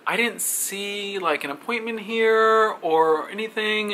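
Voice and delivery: high, slow, stretched-out puzzlement; mock-puzzled interviewer; drawn-out doubt. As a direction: imitate her hesitating, every phrase drawn out high. Acted hesitant interviewer, stretched high.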